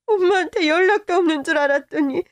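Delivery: ordinary talk, nothing marked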